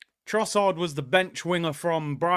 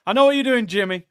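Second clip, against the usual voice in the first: higher, louder playful call; teasing call-out; 4 semitones higher, 7 dB louder, faster than usual. Real, not acted. Streamer teasing James directly, loud and playful. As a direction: call him out with a grin.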